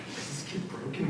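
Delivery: whispered, baffled aside; mock-concerned whisper; hushed aside. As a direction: whisper it so the kid won't hear. Adults aside about the bawling child.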